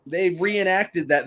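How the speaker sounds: usual speaking voice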